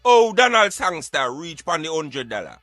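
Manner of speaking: ordinary talk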